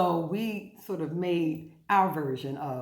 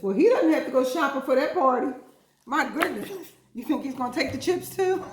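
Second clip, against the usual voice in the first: excited; 8 semitones higher, 8 dB louder than usual. Real, not acted. Lively laughing aside.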